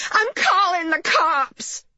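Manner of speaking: high, drawn-out threat called out; upset mother's wailing proclamation; indignant announcement. A narrator's reading. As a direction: call it out slowly in a high, upset voice. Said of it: "announced Missus Lanowitz".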